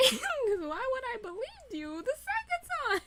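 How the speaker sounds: high squeal through laughter; laughing disbelief. Real, not acted. Fan laughing at being fooled twice, incredulous. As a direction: laugh it out, pitch way up.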